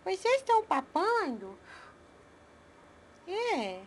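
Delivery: put-on high sing-song baby talk to pets. Owner cooing to her pets in baby talk. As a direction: coo at the little animals like a doting mom.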